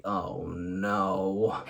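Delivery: deep, soft "Oh, no" groan; catchphrase groan, low and soft; low dismayed groan. Shown as a demonstration. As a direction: drop low and quiet in dismay. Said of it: "make you wanna say".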